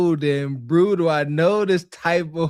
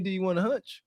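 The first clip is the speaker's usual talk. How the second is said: softer, teasing suggestive ask; mock suggestive question; 8 dB quieter, faster than usual. Man spelling out the woman's hidden offer, soft mock suggestiveness. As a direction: slip the real meaning in, soft and sly. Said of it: "That mean".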